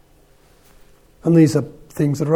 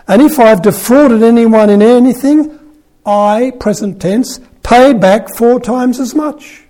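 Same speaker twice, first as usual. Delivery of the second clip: louder, higher, slowed vow; firm declaration; 6 semitones higher, 7 dB louder than usual. A narrator's reading. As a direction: proclaim the promise with weight. Zacchaeus declaring to the Lord.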